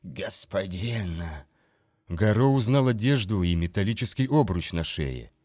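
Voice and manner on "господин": slow, soft, hollow creature moan; rasping undead voice. A narrator's reading. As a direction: drag the word out like a dying ghoul. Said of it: "когда оно попыталось говорить".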